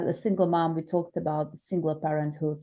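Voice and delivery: ordinary delivery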